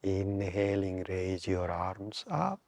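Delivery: slow, measured meditation guide with long pauses; guided meditation. Real, not acted. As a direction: draw out inhale and exhale cues to pace the breath. Leads a breathing meditation.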